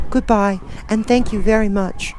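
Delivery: put-on high, worried child voice; girl voice. Dorothy asking friends what to do, high worried girl. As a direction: ask anxiously.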